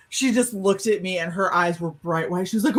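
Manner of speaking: ordinary talk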